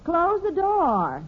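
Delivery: high, drawn-out call across rooms; calling out an order. Acted. Mother telling her son on the phone, calling out slowly. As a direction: stretch each word so he hears it.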